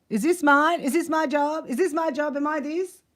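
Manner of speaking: retold panic. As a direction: squeak out your alarm at the missing job. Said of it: "I was like".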